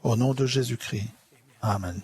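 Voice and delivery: normal talking voice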